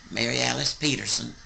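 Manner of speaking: ordinary delivery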